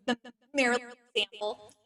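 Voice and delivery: ordinary delivery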